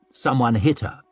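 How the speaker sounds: ordinary delivery, nothing marked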